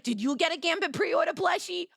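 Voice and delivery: playful fan heckle; mock shout. Joking shouted fan question.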